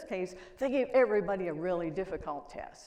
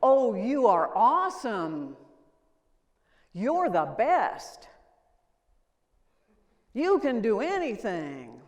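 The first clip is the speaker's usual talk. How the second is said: loud, gushing praise; loud over-the-top parental praise; 10 dB louder than usual. Retold or imitated. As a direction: pour on the praise like an adoring parent. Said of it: "I hear parents saying".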